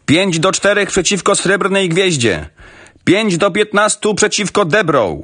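high-pitched calling of racing odds; roaring crowd. A narrator's reading. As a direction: call out the odds like a shouting crowd. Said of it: "ryczał tłum". (ryk tłumu, wysokim głosem)

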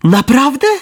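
high, bright exclaimed disbelief; joyful cry. Acted. Pinocchio hearing his father is coming, cries out with joy. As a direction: leap up with joy on the word. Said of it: "zawołał Pinokio, skacząc z radości".

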